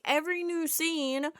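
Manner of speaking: normal talking voice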